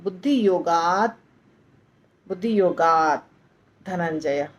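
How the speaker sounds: योगात with the tongue touching त but not releasing it; half t ending. Shown as a demonstration. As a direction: halant त. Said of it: "त पे जाता है हमारा जिह्वा".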